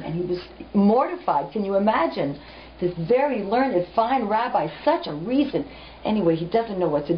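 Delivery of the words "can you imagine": ordinary talk, nothing marked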